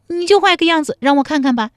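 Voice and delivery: high, loud, fast woman's dare; high-pitched coquettish heroine; half-serious challenge. A narrator's reading. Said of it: "陶三春半真半假地说".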